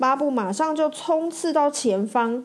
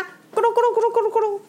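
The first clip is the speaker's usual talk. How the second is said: fast, much higher tumbling sound effect; rolling crash noise; 12 semitones higher, 3 dB louder, much faster than usual. Acted. Narrator voicing Bob's truck flipping, rolling noise. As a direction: make the truck roll over noisily.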